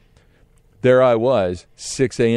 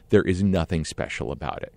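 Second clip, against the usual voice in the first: ordinary delivery, nothing marked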